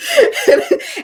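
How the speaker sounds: real high-pitched laugh. Real, not acted. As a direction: laugh at yourself. Laughing after the demo.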